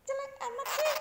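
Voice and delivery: squeaky high cooing tease at a pet; high baby talk. Acted. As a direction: coo it way up high like talking to a baby animal.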